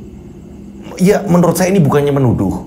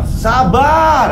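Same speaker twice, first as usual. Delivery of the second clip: calming shout; 11 semitones higher than usual. Acted. Someone tries to calm the mob.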